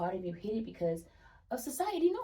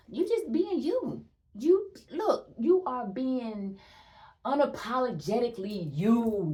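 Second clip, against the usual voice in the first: excited cheer; 5 semitones higher, 7 dB louder, slower than usual. Real, not acted. Celebrating the viewer's boldness.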